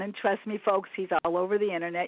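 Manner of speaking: ordinary talk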